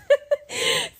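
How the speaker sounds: high-pitched burst of laughter; real high laugh mid-story. Real, not acted. Storyteller cracking up at her own tale, high laugh.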